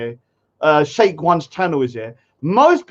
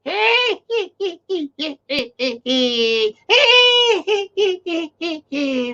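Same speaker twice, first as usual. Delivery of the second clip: high cackling laugh of a hag; long high mocking cackle; mock witch cackle; 13 semitones higher than usual. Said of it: "Halima's on there, goes".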